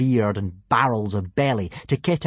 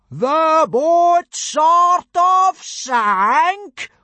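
high, loud, hugely drawn-out outrage; explosive, stretched-out bellow; incredulous roar; 8 dB louder, much slower than usual. A narrator's reading. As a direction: roar each word slowly in disbelief. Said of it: "roared Gobber".

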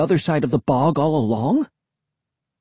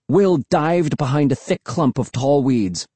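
usual speaking voice

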